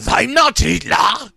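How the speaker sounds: put-on slow, high, very loud German-accented scream. Hitler-sounding man denies who he is, screaming. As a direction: scream the denial at full force. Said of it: "the Hitler-sounding man screamed".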